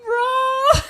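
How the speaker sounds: loud, high excited yell; excited shout. Real, not acted. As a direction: shout it out in disbelief. Reacting to super chat.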